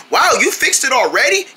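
ordinary talk

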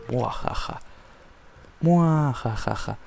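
slow hammed-up evil cackle; evil laugh. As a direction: cackle like a cartoon villain. Villain laugh at burning mob.

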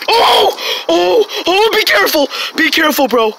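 yelling out in alarm and excitement; excited shouting. Real, not acted. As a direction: shout a warning, thrilled and alarmed. Friend grabbing a wild snake.

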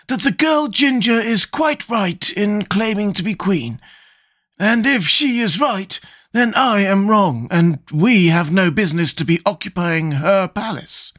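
high, slow character voice; slow, high-pitched Scarecrow, thinking aloud; deliberating Scarecrow. Acted.

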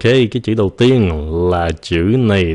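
normal talking voice

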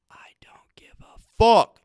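very high, loud, slow, with fuck punched hard; stress on fuck. Shown as a demonstration. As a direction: drag it out and hit fuck hard. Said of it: "'Cause you can emphasize fuck".

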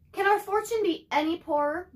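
mock lament, pitch up on our; sighing mother. Mother laments their poor luck, sighing. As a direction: sigh it out like a weary mother. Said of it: "Let me start again".

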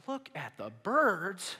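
drawn-out, mock-incredulous repeat; incredulous echo. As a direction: throw it back in exaggerated disbelief. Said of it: "You're probably thinking".

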